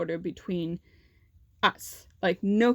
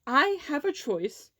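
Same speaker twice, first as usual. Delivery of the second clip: "I have a choice" slow and higher; emphatic contradiction; 6 semitones higher than usual. Retold or imitated. Student to school staff denying her choice, emphatic "I have a choice". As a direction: stretch "I have a choice" in firm retort.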